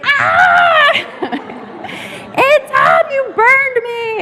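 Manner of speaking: high, loud cry of pain; pained yelp. Retold or imitated. Drunk man shouts after his hand is dunked in hot soup, loud high yelp. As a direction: yelp like a burned hand, laughing through it. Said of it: "he dunks his hand in".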